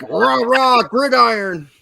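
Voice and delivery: high, loud rah-rah chant; mock fan cheer. Host mocking British NFL fans, loud mock cheer. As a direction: cheer like an overexcited, clueless fan.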